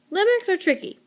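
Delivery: sing-song high Limericks; playful limericks. Shown as a demonstration. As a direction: toss out Limericks up high like it's fun to say. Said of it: "they're really fun to say".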